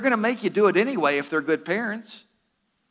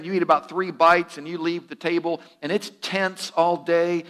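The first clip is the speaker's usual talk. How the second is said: normal talking voice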